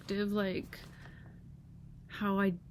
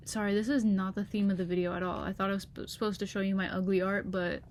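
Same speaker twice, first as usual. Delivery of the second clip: normal talking voice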